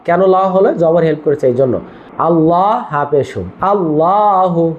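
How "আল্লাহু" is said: আল্লাহু drawn out slowly with a full, thick la; model pronunciation. Shown as a demonstration. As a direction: stretch আল্লাহু slowly so the la sounds heavy. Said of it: "কি উচ্চারণ?".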